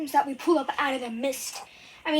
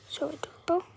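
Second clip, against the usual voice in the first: whisper; 3 semitones higher, 3 dB quieter than usual. Real, not acted. Shows stuffed animals.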